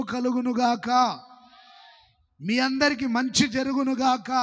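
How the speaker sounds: loud, ringing blessing over the congregation; full-force preaching. Acted. Pastor proclaiming blessing over the whole congregation, loud and ringing.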